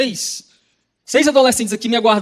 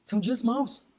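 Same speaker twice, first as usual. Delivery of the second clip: much softer, sober restatement; quiet repeat; 8 dB quieter, slower than usual. Real, not acted. Preacher repeating the warning to himself, quiet and sober. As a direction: let the words drop almost to yourself.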